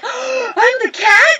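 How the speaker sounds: very high, delighted squeal of discovery; thrilled shout. Retold or imitated. Actress learns from a castmate she got the cat role, overjoyed. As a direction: burst out with joy.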